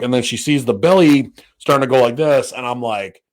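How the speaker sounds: ordinary talk, nothing marked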